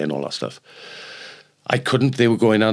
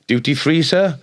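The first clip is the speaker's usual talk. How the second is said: barman's offer; 8 semitones higher, 4 dB louder than usual. Retold or imitated. Barman pops head out asking.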